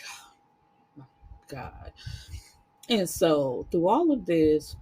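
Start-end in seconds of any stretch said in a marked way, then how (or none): 0.1-1.9 breathy whispered oh my God; whispered disbelief. Real, not acted. Reacting to the mom's insult, whispered disbelief. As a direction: drop to a stunned whisper. Exasperated aside.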